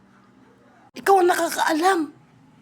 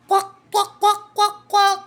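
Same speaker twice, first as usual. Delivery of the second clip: playful quacking, a bit higher; duck quack imitation; 3 semitones higher, 3 dB louder than usual. Child answering which animal says kwak, quacking. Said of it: "Di, di ba sa pato?".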